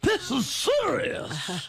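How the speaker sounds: slow faux-solemn verdict; mock grave, slow. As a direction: drag it out, mock serious.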